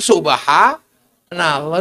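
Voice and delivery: very slow, stretched subhanallah; slow pious reaction to stepping in dung; drawn-out subhanallah. Shown as a demonstration. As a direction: let it out long and pious. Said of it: "Kaki kena tai ayam".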